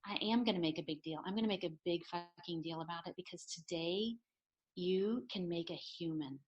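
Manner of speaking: soft, weighty mother-to-daughter declaration; earnest, solemn. Retold or imitated. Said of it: "I looked her square in the eye".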